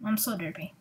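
ordinary talk

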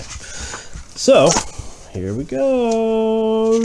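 high, slow drawn-out opener; drawn-out sing-song. Real, not acted. Parent opening a bedtime story in a slow high sing-song. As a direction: stretch it out like a storyteller.

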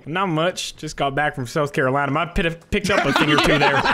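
put-on Southern accent. Player back from South Carolina, telling friends in a Southern accent. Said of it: "Fucking soup wore off on you".

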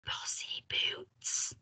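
slow, voiceless whisper; sneaky whispered insult; under his breath. A narrator's reading. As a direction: mutter it secretly, barely audible. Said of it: "very softly under his breath".

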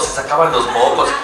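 ordinary talk, nothing marked

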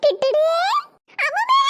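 put-on high, singsong baby talk; cooing to baby. Big sister greeting the newborn, high excited cooing.

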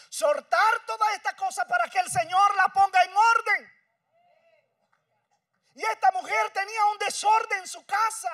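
loud, high fervent preaching; preaching at full force. Acted. Preacher to congregation, full-force loud preaching. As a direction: preach it out to the whole church.

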